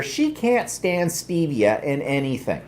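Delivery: ordinary delivery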